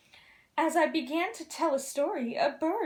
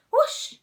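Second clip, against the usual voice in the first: whoosh; 12 semitones higher, 8 dB louder than usual. Acted. Fire bursting from dragon.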